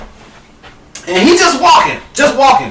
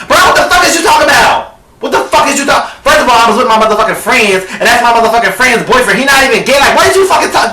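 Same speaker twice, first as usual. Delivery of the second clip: fast angry ranting; 6 semitones higher than usual. Retold or imitated. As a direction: fire it off fast and furious. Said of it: "when he mad, he talk even faster".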